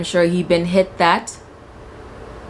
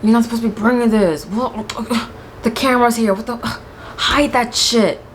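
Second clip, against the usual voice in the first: urgent hushed scolding; 5 semitones higher than usual. Pretend. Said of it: "I'd be like".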